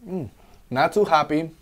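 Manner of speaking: tasting a fresh sip; savoring after drinking; just after a sip. Real, not acted. Seen 3: Drank from can at -3 to -2; now lowered, tasting and commenting.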